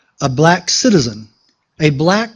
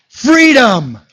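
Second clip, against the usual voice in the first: a higher, louder one-word cry; rousing shout; 5 semitones higher, 4 dB louder than usual. Acted. Speaker rousing the crowd with a one-word answer, loud. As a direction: punch it out to the audience.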